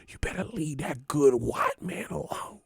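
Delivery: hushed warning whisper. Acted. Storyteller warning the fans about Tyson Fury, hushed whisper. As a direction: warn them under your breath.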